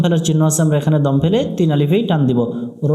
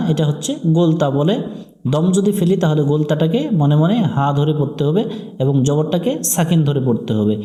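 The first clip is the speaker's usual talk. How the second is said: ordinary talk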